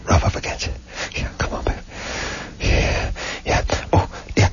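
hushed, mostly voiceless creepy murmur; hammed-up whispered moaning; mock lecherous whisper. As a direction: whisper it like a lurking peeper. Acts out lurking father spying.

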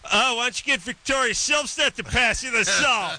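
high-pitched, hammed-up jealous snipe; mock mad girlfriend. Host mimics a jealous girlfriend at the dinner table, mock mad. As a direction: put on a high, miffed girlfriend voice. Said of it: "when chicks get mad".

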